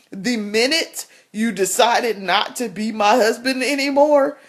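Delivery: ordinary talk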